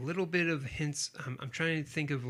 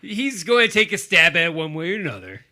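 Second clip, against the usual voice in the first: loud, hammed-up announcer; mock promo voice; 4 semitones higher, 11 dB louder than usual. Co-host joins the killer promo with a pun, mock promo voice. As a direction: sell the pun like a show teaser.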